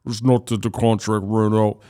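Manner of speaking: mock a distorted microphone voice; mock auto-tuned voice. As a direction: lightly imitate a demon-like processed voice. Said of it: "some auto-tune on his voice".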